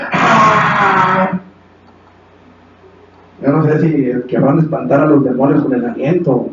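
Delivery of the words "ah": long, high mock crowd yell; imitated war cry. Preacher imitates everyone answering the leader, drawn-out mock war cry. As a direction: hold out a mocking "aah" yell. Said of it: "y todos gritan".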